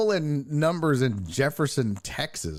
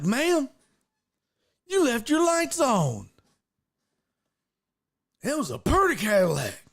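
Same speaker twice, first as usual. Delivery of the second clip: barely-there country guy; 11 semitones higher than usual. Retold or imitated. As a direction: call to the lady like a simple country fellow. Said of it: "it was kind of that vibe".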